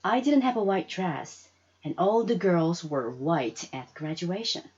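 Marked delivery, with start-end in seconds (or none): none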